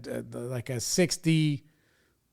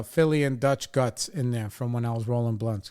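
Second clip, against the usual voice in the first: normal talking voice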